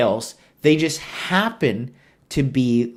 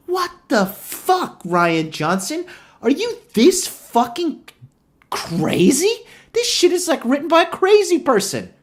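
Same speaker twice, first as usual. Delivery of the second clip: much higher, louder, strained incredulity; incredulous outburst; 13 semitones higher, 3 dB louder than usual. Real, not acted. Ranting at Rian Johnson over his writing, high incredulous shriek. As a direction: shriek it at the director in disbelief. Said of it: "just to think to myself, like".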